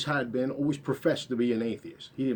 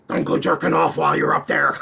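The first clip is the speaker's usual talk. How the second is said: higher, louder, fast taunting jeer; mocking friend's voice; 4 semitones higher, 9 dB louder, faster than usual. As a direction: throw the taunt out fast and loud like a sneering prankster. Scott's mocking voice in his head.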